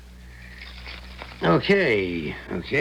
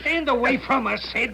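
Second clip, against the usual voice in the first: barked order; 12 semitones higher than usual. Acted. Ernie orders Sid back.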